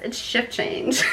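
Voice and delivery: words dissolving into a soft giggle; laughing. Real, not acted. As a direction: let a quiet laugh take over. Giggling at retold midwife line.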